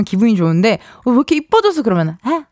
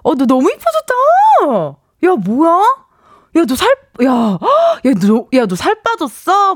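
gushing greeting; 6 semitones higher than usual. Retold or imitated. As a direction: gush at an old friend you haven't seen. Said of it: "이런 인사 많이 합니다".

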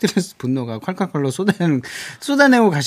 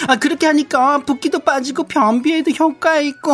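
high, sing-song coworker chatter; pleased, preening colleague; 11 semitones higher than usual. Acted. Flattered coworker giving water-drinking advice, pleased high chatter. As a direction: soak up praise and share beauty tips.

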